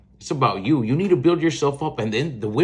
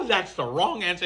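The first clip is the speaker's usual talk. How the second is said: higher, laughing through the words; amused, laughter-filled delivery; 7 semitones higher than usual. Real, not acted. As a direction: break into a laugh while saying it. Laughing after mocking dad's advice.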